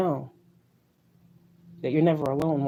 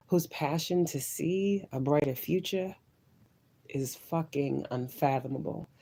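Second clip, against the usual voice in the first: ordinary talk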